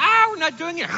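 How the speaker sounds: loud, high outcry; King Josiah reading the law, crying out high and loud; Josiah's dismay. Retold or imitated. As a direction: throw up your hands in alarm, loudly. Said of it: "He reads this and goes".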